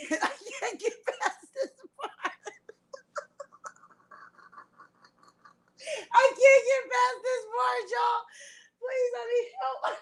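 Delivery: laughter-crying, can't get past it; laughing. Real, not acted. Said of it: "get the laughter out of me".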